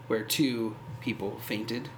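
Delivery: ordinary delivery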